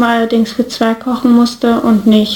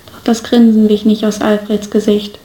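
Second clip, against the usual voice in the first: ordinary delivery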